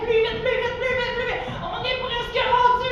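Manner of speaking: high, excited rallying chant; urgent cheering on. Pretend. Teacher urging children nearly at the top, excited.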